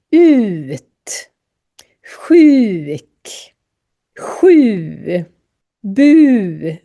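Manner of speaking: "Ut, sjuk, sju, bu" one by one, each U stretched and falling; drawn-out U drill. Shown as a demonstration. Teacher reads a list of U words, exaggerated vowel drill. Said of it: "så säger man dem speciellt".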